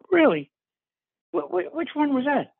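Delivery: high, stammering retold disbelief; surprised. Jerry learns his castmate did a kids' show, asking with high surprise. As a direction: sound caught off guard and curious. Fan realizes he's met a star.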